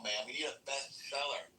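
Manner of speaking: normal talking voice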